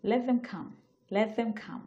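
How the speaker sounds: "Let them come" run together as one unit; teacher modelling "let them come" as natives say it; connected pronunciation. Shown as a demonstration. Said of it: "একদম এইভাবেই pronounce করবে ওরা".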